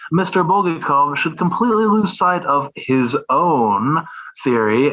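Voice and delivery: measured formal reading of a quoted text; dry, deliberate recitation with a sarcastic edge; reading aloud. Acted. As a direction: read it like a scholarly polemic, deadpan.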